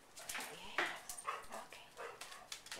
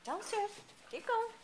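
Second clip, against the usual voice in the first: high-pitched dog encouragement; 12 semitones higher, 8 dB louder than usual. Real, not acted. Urging dog down stairs.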